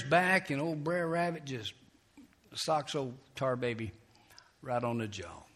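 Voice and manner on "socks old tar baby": ordinary talk, nothing marked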